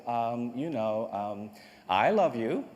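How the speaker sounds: slow, low, halting mock-reluctant confession; insincere. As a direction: force it out awkwardly, as insincerely as possible. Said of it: "as insincerely as I possibly could".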